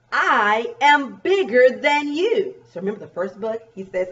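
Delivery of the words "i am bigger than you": slow, higher, louder bug boast; one-up the other bug, word by word; storybook brag with strong punch. Acted. Reads boasting bug's line.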